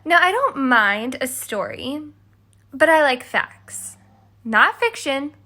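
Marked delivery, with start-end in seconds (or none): none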